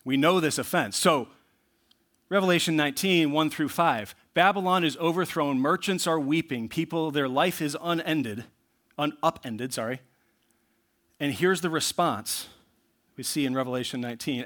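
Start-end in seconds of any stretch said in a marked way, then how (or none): none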